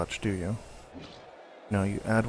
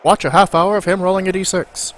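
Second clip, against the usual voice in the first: loud, high cartoon announcer; 7 semitones higher, 8 dB louder than usual. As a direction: hype it like a TV trailer. Mock TV show trailer.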